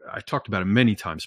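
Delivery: usual speaking voice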